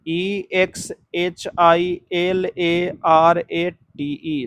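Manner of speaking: slow, letter by letter; spelling out. Real, not acted. Teacher spelling a word for the class, slowly letter by letter.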